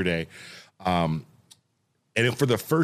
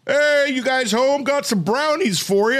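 high, loud, slow mock neighbor voice; neighbor Tom; 13 semitones higher, 4 dB louder than usual. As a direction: put on a cheery high neighbor voice. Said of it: "He's like".

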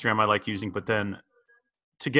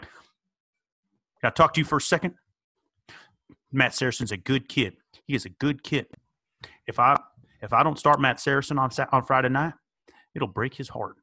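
Coach Taylor. Retold or imitated. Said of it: "Coach Taylor, who's always like".

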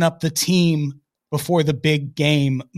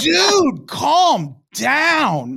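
exasperated outburst; 10 semitones higher, much slower than usual. Retold or imitated. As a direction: throw up your hands at him. Exasperated retort to Antoine.

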